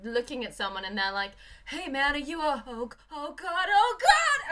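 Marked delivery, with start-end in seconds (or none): 1.7-4.5 mock dawning horror. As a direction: friendly start building to high, loud panic. Acts onlooker horrified by burned face.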